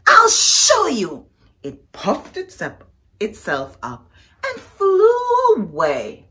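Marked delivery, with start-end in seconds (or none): 0.1-1.3 high, loud, slow threat; the grouchy ladybug vows to show them. Acted. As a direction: storm off threatening to prove yourself.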